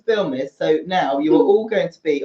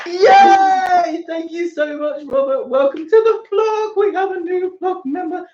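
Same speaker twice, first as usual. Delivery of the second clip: very high-pitched excited squeal; real excited celebration; 13 semitones higher than usual. Real, not acted. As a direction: squeal a thrilled welcome. Celebrating a new member joining.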